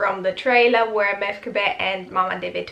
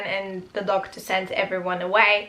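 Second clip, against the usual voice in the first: normal talking voice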